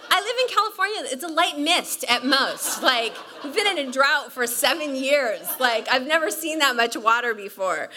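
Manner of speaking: high, loud, laughing rant; complaining to the friend about the flood, laughing; laughing protest. Retold or imitated. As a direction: protest breathlessly through laughter.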